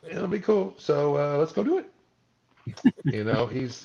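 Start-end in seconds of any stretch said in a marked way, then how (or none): 0.1-1.9 much higher, a breezy upbeat director voice; cheerfully casual about gore; imitating Chad. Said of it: "he's just like".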